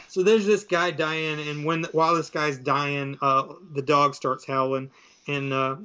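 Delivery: ordinary talk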